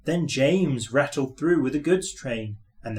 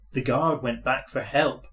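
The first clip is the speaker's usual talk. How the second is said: ordinary delivery, nothing marked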